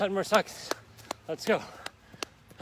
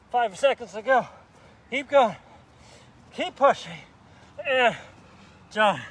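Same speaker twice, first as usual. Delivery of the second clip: building to a loud, higher push; urging coach shout; 6 semitones higher, 9 dB louder than usual. Real, not acted. Trainer pushing viewers through the last seconds, shouting higher and louder.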